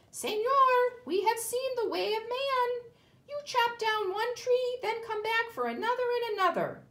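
high, loud monkey chatter. Acted.

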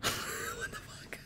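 breathless, slow whispered disbelief; barely gets the words out; stunned whisper. Real, not acted. Player stunned by the death, whispering slowly.

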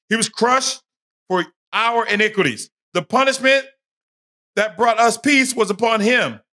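high, loud, booming scripture reading; fervent preaching. Acted. Street preacher booming the verses at Jerry, in full fervour.

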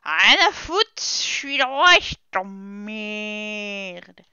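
a hint of a loud tyrant king; brash king, slowing at the end; roaring tyrant king. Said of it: "Ça, c'était pour la petite ref". (roi tyran rugissant)

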